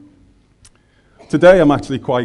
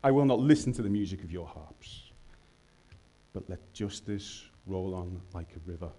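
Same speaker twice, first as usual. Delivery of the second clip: solemn scripture reading; 3 semitones higher than usual. Acted. Preacher reading Amos to the congregation as a solemn prophetic rebuke. Reads God's words from Amos.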